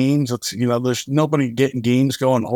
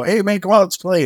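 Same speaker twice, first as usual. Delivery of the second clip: higher, upbeat retold invitation; coaxing playfully; 7 semitones higher, 3 dB louder than usual. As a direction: mimic coaxing kids into playing. Coaxing listless orphans to play.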